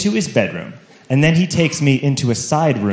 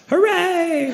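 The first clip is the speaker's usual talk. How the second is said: mock cheer; 13 semitones higher, 4 dB quieter than usual. Mock cheer about the party.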